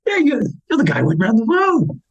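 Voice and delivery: high, stammering imitation of a fan; retold starstruck burst; excited recognition. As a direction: stumble over the words as recognition hits. Stranger keeps recognising him.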